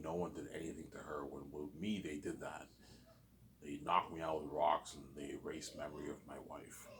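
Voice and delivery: hushed, breathy secret-telling; whispering. Real, not acted. Confiding his past to the viewer, in a whisper. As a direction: drop to a whisper as if confessing.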